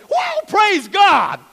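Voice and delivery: high, loud praise shout; slow, booming whoa praise God; excited shout. Retold or imitated. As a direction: shout it out like the whole office is joining in. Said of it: "everybody goes".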